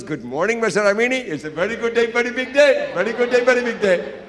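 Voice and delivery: excited hotel manager. Retold or imitated. Hotel manager greeting the guest, bursting with excitement. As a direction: bubble over with excitement. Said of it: "he was super excited".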